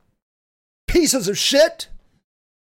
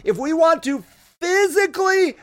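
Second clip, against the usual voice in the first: ordinary talk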